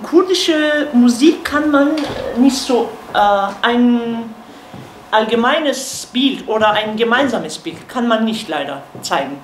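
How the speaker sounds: ordinary talk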